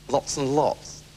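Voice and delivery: low, slow, soft fake fondness; drawn-out oily sweetness; sickly sweet. Septimus faking affection to his brother.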